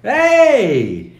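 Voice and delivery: bright high shouted hey; loud greeting. Real, not acted. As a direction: call out a big hello. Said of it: "But not too loud".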